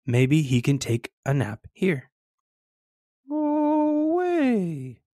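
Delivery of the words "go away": very high, drawn-out fish voice; slow, high-pitched cartoon fish; father fish. A narrator's reading. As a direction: stretch it out way up high. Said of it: "says an awake father fish".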